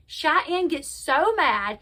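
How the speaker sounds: ordinary talk, nothing marked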